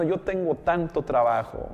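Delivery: ordinary talk, nothing marked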